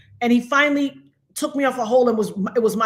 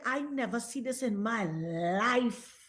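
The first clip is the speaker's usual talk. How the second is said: slow, very soft retold amazement; hushed, drawn-out disbelief; stunned disbelief; 2 semitones lower, 12 dB quieter, slower than usual. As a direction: draw it out quietly, as the shocked supervisor.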